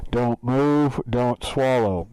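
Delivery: loud, overmodulated robot order; mock electronic command; distorted machine voice. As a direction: imitate the scanner's tinny speaker. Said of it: "it sounds like that, by the way".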